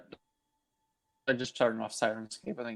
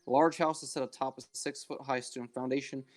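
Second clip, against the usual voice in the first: scene narration. Acted. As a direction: set the scene, unhurried. GM scene setting.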